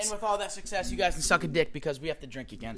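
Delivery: usual speaking voice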